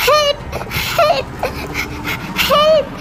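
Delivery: high, drawn-out wailing calls; crying for help. Acted. Drowning child calling for help, in panic. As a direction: gasp out each cry, stretching it.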